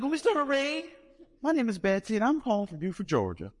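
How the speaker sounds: put-on Southern accent. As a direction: gush like a Buford church lady. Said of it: "I can tell from your accent".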